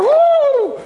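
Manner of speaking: very high, loud whoop; cold-shock whoop. Retold or imitated. Preacher acting out stepping into freezing water, a loud high whoop. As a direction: yelp like icy water just hit you.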